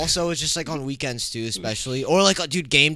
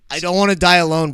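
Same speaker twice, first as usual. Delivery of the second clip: mock despair; 5 semitones higher, 9 dB louder than usual. Jokey despair about singleness.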